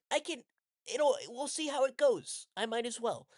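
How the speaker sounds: mock the silly girl in a high airhead voice; mocking bratty girl. Mocking the middle school girl's dating advice, high ditzy voice. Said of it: "She says".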